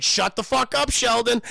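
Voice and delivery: high, loud retort; acted angry snap. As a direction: shut him down sharply, mock fury. Leonard snaps at tactless Sheldon.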